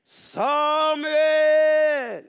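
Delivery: loud, high, long-held call; Gud calling out. A narrator's reading. Storyteller as the voice waking Samuel, loud drawn-out call. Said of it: "Gud som ropte på Samuel".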